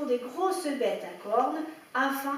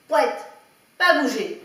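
much louder firm command; stern order; 9 dB louder than usual. A narrator's reading. Farmer ordering the muddy dog to stay put, loud command. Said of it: "ordonna-t-il".

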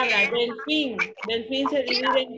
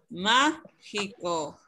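slowly modelled word; 3 semitones lower, much slower than usual. Shown as a demonstration. Teacher repeats word slowly.

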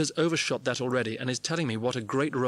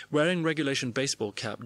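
ordinary delivery, nothing marked

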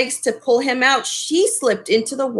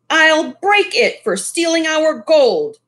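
vowing; 6 semitones higher, 3 dB louder than usual. A narrator's reading. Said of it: "vowed Mister Lee".

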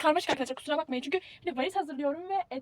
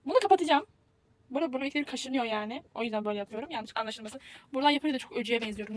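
ordinary talk, nothing marked

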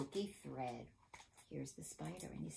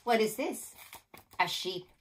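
plain reading voice

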